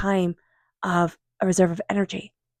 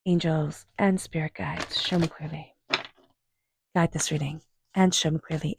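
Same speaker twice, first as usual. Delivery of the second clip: quiet invocation; 6 dB quieter than usual. Real, not acted. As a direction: murmur the call to the angels, unhurried. Invoking spirit guides while shuffling.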